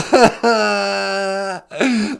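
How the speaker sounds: hammed-up fake sobbing wail; mock sob. The caller fake sobs because nobody believes him. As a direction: let out a theatrical fake sob.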